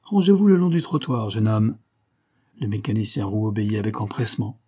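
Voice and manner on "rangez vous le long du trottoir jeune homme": raised, loud order that drops at the end; brusque cop's command; gruff policeman. A narrator's reading. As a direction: order the driver over, gruffly. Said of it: "dit d'un ton bourru".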